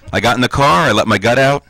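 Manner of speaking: ordinary talk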